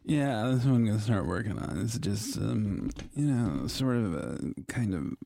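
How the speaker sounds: low creaky podcast drawl; vocal fry, slow and low. Pretend. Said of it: "Vocal Fry podcast voice".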